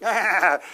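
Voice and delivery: a sharp, high, loud mock cackle burst; imitated cackle. Lecturer imitating Rothbard's laugh, a high, loud mock cackle. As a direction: fire off a machine-gun cackle in imitation. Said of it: "He cackled, he would go".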